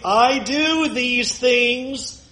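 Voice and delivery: a hint of a proud, slow Pharisee; lightly voiced smug Pharisee; Pharisee's claim. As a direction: lay out good deeds, slowly and smugly. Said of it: "Do you hear the Pharisee?".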